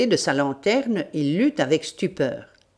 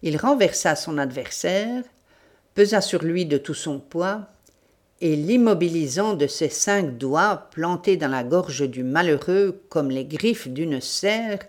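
ordinary delivery, nothing marked

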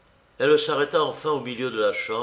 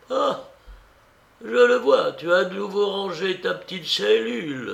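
high, emphatic aunt voice; lively old aunt; 8 semitones higher, 4 dB louder than usual. Acted. Aunt finding her niece's little room tidied, lively exclamation. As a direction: exclaim it high and loud, then ease. Aunt's figure in the story.

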